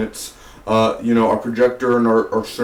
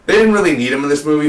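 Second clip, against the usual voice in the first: ordinary talk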